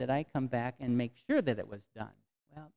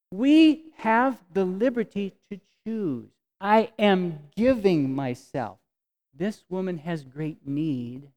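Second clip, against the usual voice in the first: slow, deliberate, sincere; preacher speaking from conviction; earnest conviction; 3 semitones higher, 6 dB louder, slower than usual. Acted. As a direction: lay it down slowly and firmly.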